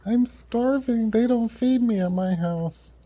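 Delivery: dog's voice. Pretend. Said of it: "He's like".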